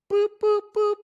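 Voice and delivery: high beeping boop sounds; imitated hang-up beeps. Coach imitates the client hanging up on his call, high beeps. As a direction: mimic the line cutting off.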